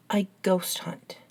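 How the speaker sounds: normal talking voice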